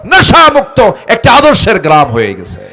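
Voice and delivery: loud, high-pitched proclamation; full-force preaching. Real, not acted. Preacher proclaims a drug-free model village to the crowd, full-force. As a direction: preach it at the top of your voice.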